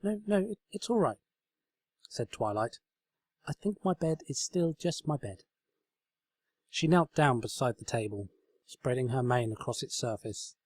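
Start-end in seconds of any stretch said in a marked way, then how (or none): 0.0-5.4 put-on higher, earnest young mare; sincere, eager explaining; higher pony voice, earnest; Twilight voice. A narrator's reading. Twilight reassures.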